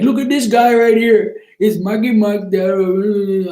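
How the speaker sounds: mock the streamer jeering at a rival; mocking imitation. Streamer dissing rivals on his live, hammed-up mockery. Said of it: "He was like".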